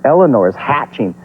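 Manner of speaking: ordinary talk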